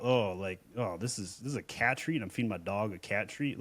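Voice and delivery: a hint of a puzzled pet owner, said under the voice; hesitant shopper thinking aloud. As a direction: think it through quietly as a doubtful buyer. Voices confused shopper's inner thought.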